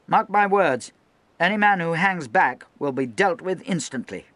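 high, loud stern speech; rally and warn the men at once; loud harangue. Acted. Lieutenant addressing the gun crews, high loud harangue.

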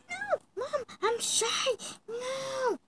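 put-on very high, whining little girl refusal; shy whiny girl. Daughter refuses her mom, shy and whiny in a high voice. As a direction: whimper it like a bashful child.

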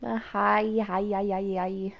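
usual speaking voice

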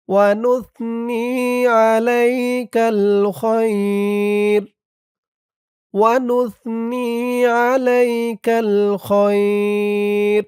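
slow chant, আলাইকাল drawn long; slow recitation. Shown as a demonstration. Teacher modelling the stop on খৈর, slow chant. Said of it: "খৈর এভাবে বলতে হবে".

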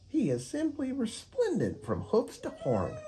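high, dreamy parakeet; a fluttery bird swooning over Unicorn. Acted. Parakeet character voice.

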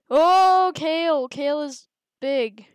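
loud, high yelp easing off; startled cry, settling by the end; startled alarm. Real, not acted. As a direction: burst out in alarm at a sudden threat. Big rival cell appears.

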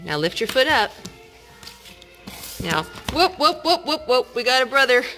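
ordinary talk, nothing marked

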